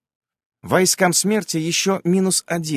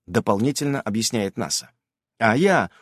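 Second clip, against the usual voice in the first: usual speaking voice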